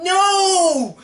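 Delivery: long, high-pitched drawn-out "no"; anguished movie-goer cry. Retold or imitated. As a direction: re-enact a horrified wail at the screen. Acting out shock at Charles's stabbing.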